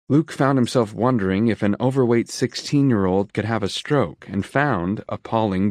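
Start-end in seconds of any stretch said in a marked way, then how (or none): none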